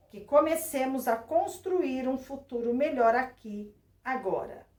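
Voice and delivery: dignified, hopeful public address; solemn speech reading. Shown as a demonstration. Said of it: "releia o trecho abaixo em voz alta. Atente à entonação".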